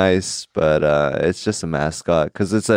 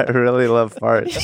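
laughing through the line; cracking up mid-sentence; 4 semitones higher than usual. Real, not acted. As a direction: giggle while saying it.

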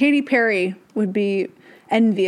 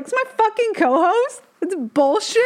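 mock outrage; 10 semitones higher than usual. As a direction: act scandalized by the photo. Showing family her co-host's selfie.

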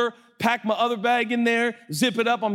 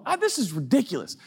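ordinary delivery

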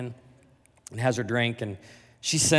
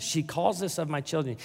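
ordinary talk

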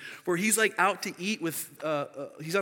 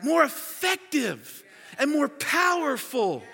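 loud, high, forceful sermon delivery; raised pulpit voice; impassioned preaching; 10 semitones higher, 6 dB louder than usual. Real, not acted. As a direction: preach it hard, hitting the key words.

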